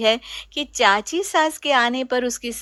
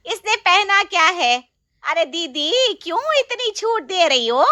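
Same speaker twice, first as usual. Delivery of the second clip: higher, louder indignant aunt; angry shout; 7 semitones higher, 4 dB louder than usual. Acted. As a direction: shout your outrage. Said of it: "इतना क्यों चिल्ला रही हो". (चाची गुस्से में चिल्लाती)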